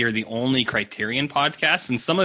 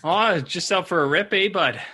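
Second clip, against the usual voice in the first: mock Canadian; 5 semitones higher than usual. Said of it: "That kind of stuff".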